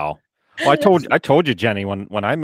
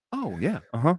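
softer, higher mock-agreeing murmur; feigned understanding; 4 semitones higher, 8 dB quieter than usual. Retelling how he listened to his friend, pretend understanding. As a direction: nod along as if you follow.

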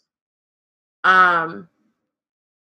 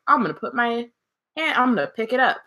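mock naive; 6 semitones higher than usual. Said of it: "he's like".

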